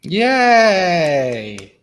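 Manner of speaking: loud excited yay; enthusiastic cheer. Acted. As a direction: cheer it out big. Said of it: "That was the enthusiastic harsh".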